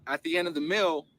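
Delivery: ordinary talk, nothing marked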